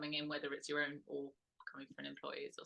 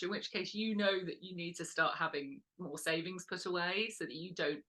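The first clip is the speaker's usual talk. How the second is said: normal talking voice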